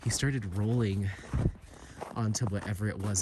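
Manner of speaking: usual speaking voice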